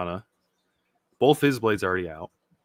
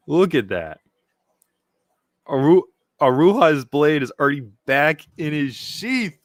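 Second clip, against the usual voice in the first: ordinary talk, nothing marked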